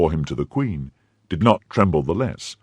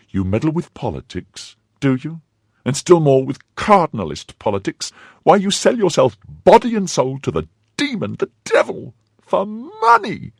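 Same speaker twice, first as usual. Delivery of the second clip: high wife's voice climbing higher and louder; wife's mounting anger; 12 semitones higher, 4 dB louder than usual. A narrator's reading.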